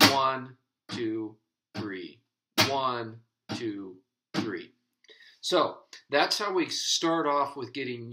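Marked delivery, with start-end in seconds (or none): 0.1-4.7 steady count leaning on "One"; stress on beat one. Shown as a demonstration. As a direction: hit every "One" harder than "two" and "three". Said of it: "all I'm doing is emphasizing that, uh, first beat".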